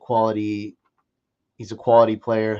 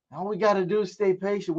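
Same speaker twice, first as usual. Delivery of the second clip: ordinary delivery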